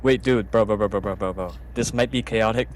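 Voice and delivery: ordinary talk, nothing marked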